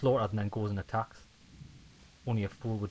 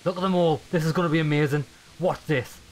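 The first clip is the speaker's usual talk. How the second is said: excited; 5 semitones higher, 6 dB louder than usual. Real, not acted. Gleeful before charging enemies.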